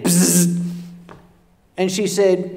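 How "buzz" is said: a short mimicked insect buzz; imitated buzzing. Mimicking the mystery buzz he hears in the bathroom, lips buzzing. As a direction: buzz through the lips like a bug. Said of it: "Then I heard it again".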